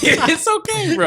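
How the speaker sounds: very high-pitched mock comforting; teasing sympathy. Real, not acted. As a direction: coo over a friend in falsetto. Teasing co-host about red eyes.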